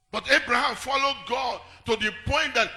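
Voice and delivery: loud, high call; preaching shout. Acted. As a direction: lift it up and shout.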